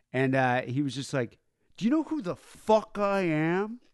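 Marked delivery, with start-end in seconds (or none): 1.8-3.8 mock-drunk rock star yelling, higher; raging drunk demanding to be recognized; drunken rage. Drunk rocker rants at bartender.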